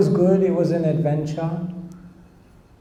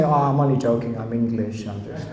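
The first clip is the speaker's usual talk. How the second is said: back to English voice; 6 semitones lower than usual. Retold or imitated. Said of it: "I would just say".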